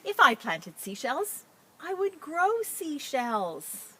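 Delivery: expressive picture-book read-aloud; reading to children. Acted. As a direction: read it out brightly to a class. Teacher reads picture book aloud.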